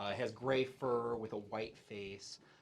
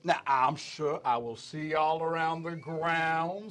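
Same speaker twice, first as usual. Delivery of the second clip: loud, slow, grandiose elephant proprietor with Southern drawl; booming folksy showman; 7 semitones higher, 12 dB louder, slower than usual. Acted. Elephant proprietor welcoming new arrivals, booming folksy drawl. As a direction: greet the crowd grandly, stretching the words. Game master voices elephant folk.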